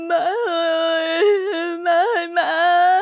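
high falsetto voice, slow and crying; whimpering to mom. Acted. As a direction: sob it out to your mother like a grown daughter. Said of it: "Con Mai mếu máo nói".

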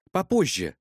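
ordinary delivery

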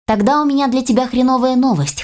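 ordinary delivery, nothing marked

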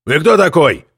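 annoyed, sharp question; irritated. A narrator's reading. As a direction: bite it out, irritated. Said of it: "раздражённо спросил Нуртфельд".

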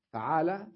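فعالة said as faʕala, open a after the f; fatha on the fa. Shown as a demonstration. Teacher drilling the vowel for students, first syllable fa- clearly. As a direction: spell out فعالة with a clear fa- sound. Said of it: "بتثليث الفاء".

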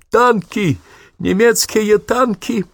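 high alarmed shout, slow on second; enemy spotted shout. Acted. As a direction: scream the warning in panic. Sergeant shouts tanks spotted. (крик в панике)

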